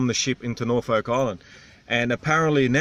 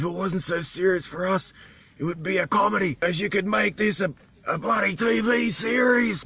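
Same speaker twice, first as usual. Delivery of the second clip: higher, strained local voice; islander's animated voice; 8 semitones higher than usual. Retold or imitated. Vlogger imitates islander ranting about vests and boots, strained high. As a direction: push the voice up like the animated old islander.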